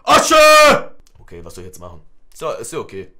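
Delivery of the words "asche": loud, high imitated yell; sudden full-voiced mimicked shout; shouted from behind. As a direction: yell the name like a heckler. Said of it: "einer schreit schon von hinten".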